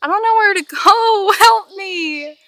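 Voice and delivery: high, loud half-laughing panic at being lost; playful panic. Real, not acted. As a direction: wail for help, half in jest. Lost in the woods, half-joking.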